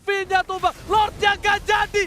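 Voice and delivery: excited play-by-play call; hyped esports commentary; esports caster. Real, not acted. Live casting hype call.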